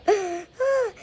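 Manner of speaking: high swooning gasp; mock gasp of delight; lovestruck gasp. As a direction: gasp like a smitten schoolgirl. Lovestruck fan gasps.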